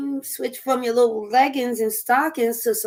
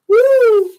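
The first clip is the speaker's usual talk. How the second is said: short high "woo" let out hard; big exhaled whoop; loud high whoop; 9 semitones higher, 10 dB louder than usual. Real, not acted. Exclaims after finishing her list.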